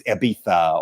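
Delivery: "Ibiza" with a th for the z; affected Spanish pronunciation. Retold or imitated. Mocking Americans who went to Spain, affected Spanish Ibiza. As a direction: say Ibiza the Castilian way, with th. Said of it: "one of those Americans that goes to".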